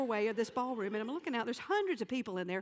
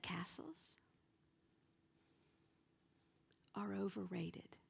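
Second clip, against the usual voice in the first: hushed; 4 semitones lower, 9 dB quieter than usual. Real, not acted. Comedian sharing a tender lesson with the audience, whispered.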